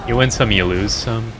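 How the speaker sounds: ordinary talk, nothing marked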